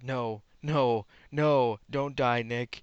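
loud, drawn-out mock wail of "no"; anguished plea. A narrator's reading. Gwen begging dying Nick to live, hammed-up wail. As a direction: cry out in hammed-up grief, stretching the words. Mourner pleads over dead lover.

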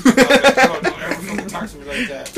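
loud, laughing mock scolding; mock stern warning. Scolding a pushy hustler after slapping him, loud mock warning. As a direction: bark it through laughter like a threat.

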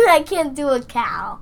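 usual speaking voice

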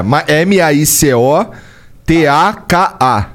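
slow, letter by letter; spelling it out. Shown as a demonstration. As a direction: spell each letter slowly. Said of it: "vou soletrar de novo".

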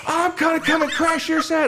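very high, hammed-up threat; comic high-pitched menace; mock angry threat. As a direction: imitate an irate manager in a squeally high voice. Acted threat from angry manager.

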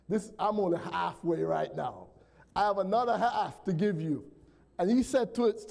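ordinary talk

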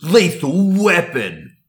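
slow, high epic trailer announcer; movie trailer voice. Acted. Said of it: "do it with the movie voice as well".

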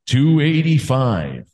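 slow, dramatic announcer drawl; wrestling promo. Pretend. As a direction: stretch it out like a hype man. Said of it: "Nice promo".

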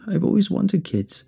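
high, crackling and stuttering. A narrator's reading. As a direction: voice crackles with tears. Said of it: "crackling, stuttering".

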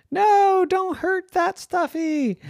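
put-on squeaky, urgent little-girl plea; a girl begging them to stop; high pleading girl. Becky supplie.

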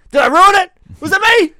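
very high, loud mock wail; yelling. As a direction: shriek it like your clueless yelling mate. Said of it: "just keeps yelling".

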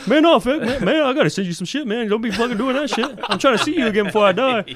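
high, loud, worked-up friend impression; mock freak-out. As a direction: imitate a buddy pleading at full volume. Imitates friend freaking out at him.

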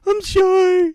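very high squeaky voice; Mickey Mouse. Retold or imitated. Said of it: "be on key there".

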